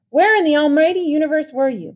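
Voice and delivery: high, loud exasperated demand; angry yell. A narrator's reading. Brother yelling at Pony at two in the morning, exasperated. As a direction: peak of the scolding. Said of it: "His voice was rising".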